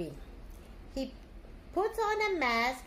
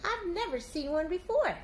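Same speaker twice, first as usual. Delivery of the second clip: eager; 5 semitones higher than usual. Acted. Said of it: "said Pete".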